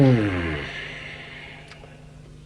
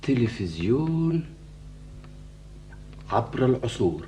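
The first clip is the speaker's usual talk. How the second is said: awed musing; 10 dB quieter than usual. Acted. Awed by new idea.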